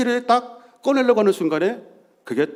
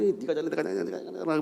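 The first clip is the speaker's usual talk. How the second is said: grumbling; 4 semitones higher, 8 dB quieter, faster than usual. Retold or imitated. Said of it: "툭툭툭툭툭툭 막".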